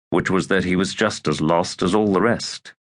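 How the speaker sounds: usual speaking voice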